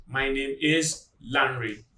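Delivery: slow, with "is" kept as a separate word; uncontracted "is". Shown as a demonstration. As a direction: say "name is" in full, without merging. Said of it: "or do not say".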